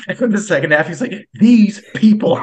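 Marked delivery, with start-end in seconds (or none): 1.3-2.4 high, loud, slow exasperated sigh of words; hammed-up disgust, drawn out; mock outrage, slow and high; mock exasperation. Mocking exasperated speaker.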